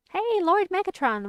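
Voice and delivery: loud, high, delighted hail; excited greeting. Real, not acted. Streamer welcoming a viewer to the chat, excited loud greeting. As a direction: call out high to a new arrival.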